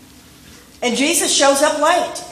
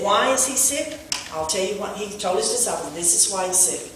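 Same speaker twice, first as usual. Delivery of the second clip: softer, lower, hushed explaining; quiet retelling; 4 semitones lower, 8 dB quieter than usual. Acted. Preacher softly explaining why Lazarus is sick, hushed. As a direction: bring it down close and gentle. Soft retelling of Jesus' words.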